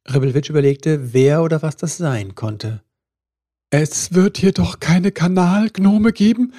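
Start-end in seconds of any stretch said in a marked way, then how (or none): 3.7-6.5 higher, louder horrified thought; horrified elf thinking aloud. A narrator's reading. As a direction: gasp it out in horror. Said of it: "dachte er entsetzt".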